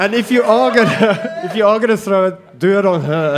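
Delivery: loud laughing warning; laughing, half-shouted warning. Real, not acted. Joking warning before shot.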